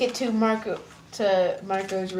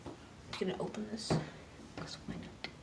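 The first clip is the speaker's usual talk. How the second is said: much softer, unvoiced whisper; muttered aside; 16 dB quieter than usual. Real, not acted. Vlogger opening something, whispered aside. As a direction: murmur to yourself. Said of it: "aside muttered off mic".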